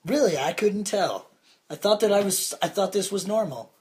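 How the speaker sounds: sarcastic. Pretend. Sarcastic mock surprise.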